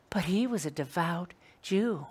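hushed whisper for emphasis. Real, not acted. Preacher to the study group about Peter, whispered. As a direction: whisper it like a secret truth.

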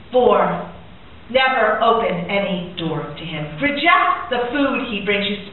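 ordinary talk, nothing marked

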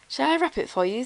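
high, polite offer; helpful shopkeeper. A narrator's reading. Shopkeeper offering grandfather wrapping, helpful. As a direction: lift the pitch into a customer-service question.

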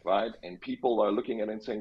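ordinary delivery

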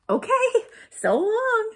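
very high, loud chirpy farewell; squeaky-high, breezy cheer; cheerful. A narrator's reading. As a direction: sing out a carefree goodbye. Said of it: "said Rosie cheerfully".

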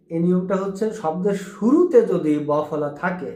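normal talking voice

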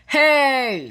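loud, high storybook shout. A narrator's reading. Big man calls out over the crashed piano, shouting. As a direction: call it out sharply, pitched well up. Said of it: "shouted the big man".

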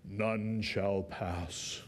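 very slow, low, deadpan knight's voice. Retold or imitated. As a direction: drag out each word like an immovable guard.

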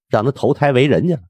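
ordinary delivery

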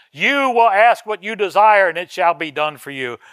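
loud, emphatic Bible reading; ringing pulpit declamation; forceful scripture preaching. Acted. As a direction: proclaim the verse to the whole church at full voice. Preacher declaims scripture over plain talk.

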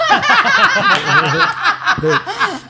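high, loud, sustained cackling laugh; real burst of laughter. Real, not acted. Listeners reacting to proposal story, loud high laughter. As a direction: crack up helplessly at the joke. Group laughs at his joke.

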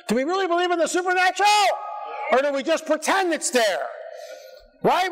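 fast, high shouted challenge; preaching shout. Real, not acted.

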